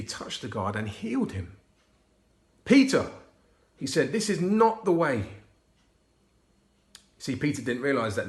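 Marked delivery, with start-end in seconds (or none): 2.7-3.1 sharp, loud, high call of a name; firm call. A narrator's reading. Jesus stopping Peter's attack. 4.1-5.4 firm rebuke. A narrator's reading. Jesus rebuking Peter.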